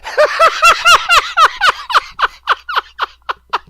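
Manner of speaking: long, high, loud laughing fit; howling laugh. Real, not acted. Caller on the phone, howling with laughter. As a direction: howl with laughter.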